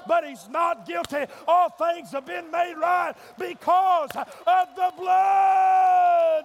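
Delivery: rising, shouted sermon climax; preaching at full force. Acted. Preacher shouting to the church about the judge. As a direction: preach it loud to the congregation.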